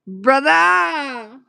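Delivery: lightly voiced greeting call to brother; playful mock hail across the room. Cheering her cousin's brother as he arrives, a playful mock call.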